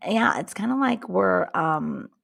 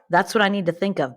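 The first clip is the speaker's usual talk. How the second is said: ordinary delivery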